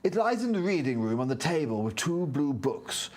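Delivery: nerdy character reading his lines; nerd voice. Acted. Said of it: "do your nerd voice".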